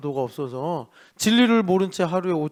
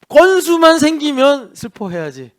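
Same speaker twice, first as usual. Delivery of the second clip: mock resolve; 10 semitones higher, 8 dB louder than usual. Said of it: "그러고 사는 거예요".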